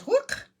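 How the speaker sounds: sudden high "whook" sound; high, mock slip noise "whook"; whoosh noise. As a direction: squeak out the stamp slipping. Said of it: "all of a sudden it goes".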